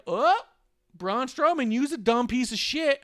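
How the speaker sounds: high, loud mock taunt; mock Shane McMahon taunt. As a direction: sneer it high and loud at the big guy. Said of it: "Shane's all like".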